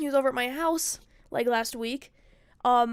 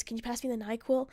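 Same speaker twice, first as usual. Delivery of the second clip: gentle bedside question, softer; soft request; 6 dB quieter than usual. Retold or imitated. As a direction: ask softly, as if waking someone sleeping. Gently waking sleeping boyfriend.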